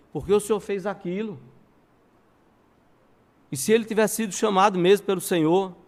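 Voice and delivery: high questioning voice of a student; Charles Spurgeon's student questioning. Retold or imitated. Student challenges the pastor over sending the man away, questioning. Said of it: "Um dos seus alunos procurou depois".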